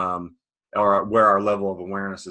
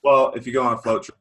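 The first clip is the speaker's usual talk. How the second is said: usual speaking voice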